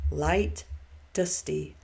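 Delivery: ordinary delivery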